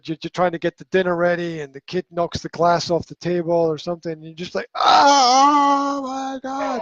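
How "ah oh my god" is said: high, strained cry of exasperation; exasperated outburst. Retold or imitated. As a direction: act out a parent losing it over a spill. Acts out overreacting to spilt glass.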